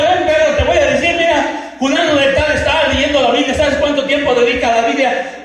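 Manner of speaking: loud, high, commanding voice of God; voicing God. God shows the pastor who reads the Bible, loud commanding. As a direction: boom it out like God calling you over. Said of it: "si Dios me agarraba y me decía".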